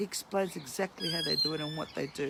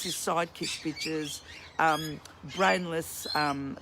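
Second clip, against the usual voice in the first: ordinary talk, nothing marked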